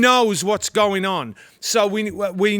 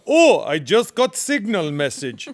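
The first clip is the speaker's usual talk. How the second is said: mock Farsi accent. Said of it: "Even his Farsi is better".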